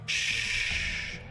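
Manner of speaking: shushing. Retold or imitated.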